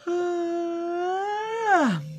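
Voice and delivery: long high yawning groan; drawn-out sleepy yawn; big yawn. Real, not acted. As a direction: let out a big, loud yawn that falls at the end. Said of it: "I got a big yawn".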